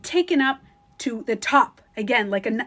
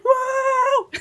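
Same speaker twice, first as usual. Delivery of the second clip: very high, loud, drawn-out wail; amazed whoa; 14 semitones higher, 5 dB louder than usual. Retold or imitated. Meditator flying back through space, high amazed whoa. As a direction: hold a high cry as if flung backwards. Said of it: "Literally in my head".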